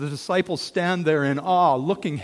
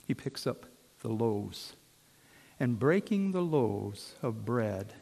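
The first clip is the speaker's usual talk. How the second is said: normal talking voice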